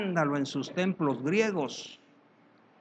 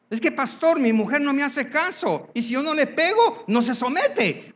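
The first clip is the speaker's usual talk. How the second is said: much higher, whiny excuse; mock husband; 10 semitones higher, 4 dB louder than usual. Pastor mimicking a husband who beats his wife, high whine. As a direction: whine it like a man justifying himself.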